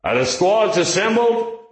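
rasping bullhorn call, high and slow; through a megaphone. Acted. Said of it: "he barked in a rasping voice".

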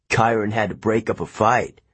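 usual speaking voice

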